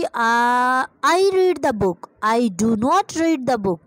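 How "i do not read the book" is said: each word spelled out slowly; slow teacher dictation. Shown as a demonstration. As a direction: dictate it word by word for students to copy. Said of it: "question mark और full stop को right करना नहीं भूलना".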